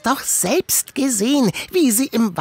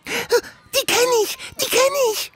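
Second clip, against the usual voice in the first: excited recognition; 9 semitones higher than usual. Acted. Chipmunk eagerly telling the parents he knows the kids. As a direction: blurt it out, thrilled to know them.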